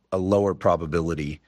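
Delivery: ordinary talk, nothing marked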